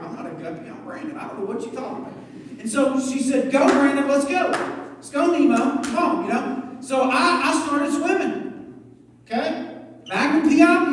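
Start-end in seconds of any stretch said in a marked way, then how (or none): 0.0-2.1 much softer, lower, grumbling to himself; a little boy sulking under his breath; muttered kid protest. Retold or imitated. Young Brandon refusing the swim teacher, softly grumbling. 3.5-6.2 louder, urging him on; rally a nervous kid to swim; cheering teacher. Retold or imitated. Swim teacher cheering little Brandon down the pool, loudly.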